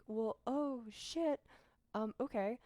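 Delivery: high, loud shocked cry on shit; startled outburst that settles back; surprised swear. Retold or imitated. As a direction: caught off guard, let it slip. Realising the school is residential.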